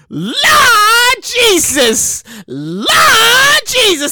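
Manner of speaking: loud, high, drawn-out cry to heaven; hammed-up exclamation. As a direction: stretch it out in mock shock. Hammed-up reaction to a post.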